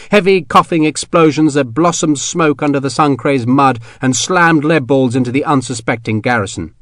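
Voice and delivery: ordinary delivery, nothing marked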